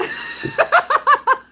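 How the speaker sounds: long shrieking high laugh; high squealing laugh. Real, not acted. Streamer cracking up at her own [name] joke, shrieking laugh.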